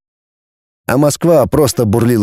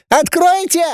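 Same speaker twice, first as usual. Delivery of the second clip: even higher repeated shriek; piercing shriek. A narrator's reading. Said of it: "завопила пронзительным голоском".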